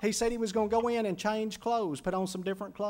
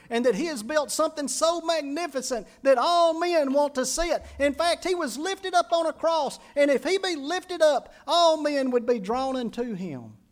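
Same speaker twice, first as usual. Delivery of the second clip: high, loud preacher's build; rising sermon climax; preaching at full force; 6 semitones higher, 6 dB louder than usual. Acted. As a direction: lift the congregation, louder and higher.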